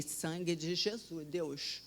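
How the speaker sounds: softly murmured frightened plea, a bit slow; scared prayer. Retold or imitated. Alone in the grey ICU, praying to God in fear, softly. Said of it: "eu tava com medo danado".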